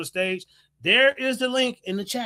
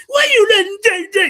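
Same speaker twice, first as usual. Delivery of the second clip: high, loud mock whining protest; shouted mock grievance; mock complaint; 12 semitones higher, 10 dB louder than usual. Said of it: "That's what they do".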